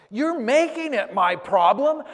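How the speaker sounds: very loud, high shout; retold shout. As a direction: yell it right in his face. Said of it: "start yelling".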